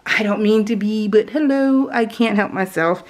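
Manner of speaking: ordinary talk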